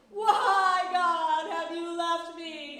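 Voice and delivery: loud, drawn-out wail of complaint; anguished cry. Acted. Teacher acting out David questioning God, loud anguished cry. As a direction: wail it out, big on the first word. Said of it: "he's saying".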